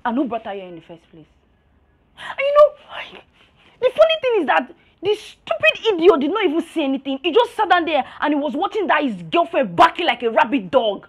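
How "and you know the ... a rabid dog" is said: loud, heated tirade; angry rant. Acted. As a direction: rail indignantly at the useless boyfriend. Friend rants about the boyfriend.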